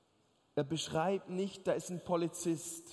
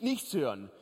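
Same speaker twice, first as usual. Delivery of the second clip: usual speaking voice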